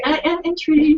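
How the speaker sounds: lilting, playful sing-song. Real, not acted. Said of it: "my sing-song voice".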